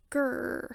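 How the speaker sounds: little pretend "grrr"; mild, drawn-out storybook growl; playful dinosaur growl. A narrator's reading. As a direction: growl like a toddler playing dinosaur. George roars like a dinosaur.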